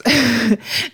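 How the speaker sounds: short, high laugh to herself; amused laugh. Real, not acted. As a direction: laugh briefly at your own tangent. Laughs at her own tangent.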